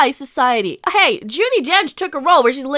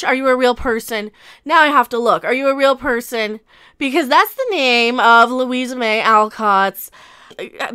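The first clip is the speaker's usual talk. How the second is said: ordinary delivery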